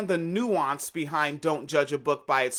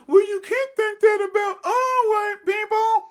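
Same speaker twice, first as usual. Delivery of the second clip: high, loud mocking whine; a scornful high yelp of the naysayer; mocking objector; 6 dB louder than usual. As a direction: shout it as a whiny critic. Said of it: "people can be like".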